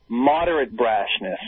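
slow ironic emphasis; sarcastic. Acted. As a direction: savor the phrase mockingly. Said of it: "first saying of phrase".

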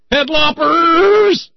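shrieked very high and loud, stretched slow; a hammed-up cartoon squeal; high, slow comic shriek; mock shriek. Mock outburst about isis.

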